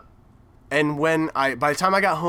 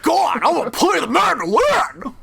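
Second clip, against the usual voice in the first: much higher, shouted mock Cage voice; over-the-top Nicolas Cage; 13 semitones higher, 5 dB louder than usual. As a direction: yell it like a cartoon Nicolas Cage. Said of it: "impersonation of Nicolas Cage".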